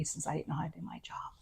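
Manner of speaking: whispered, barely voiced; a bitter whispered aside; hushed aside. Real, not acted. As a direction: drop to a whisper as if sharing a secret. Bitter aside about the takeover.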